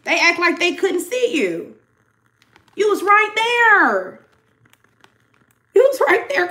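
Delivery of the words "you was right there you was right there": high, loud, slowed emphasis; emphatic insistence. Real, not acted. Reader telling the collective they were there, high and emphatic.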